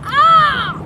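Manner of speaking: loud high thrilled scream. Real, not acted. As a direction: let out a scream of excitement. Scream on the ride.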